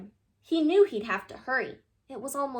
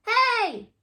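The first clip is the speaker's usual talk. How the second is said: loud high yell; 11 semitones higher, 7 dB louder than usual. Acted. Paper boy yelling after the rabbits, loud. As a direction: stop the thief with a shout. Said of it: "yelled the boy".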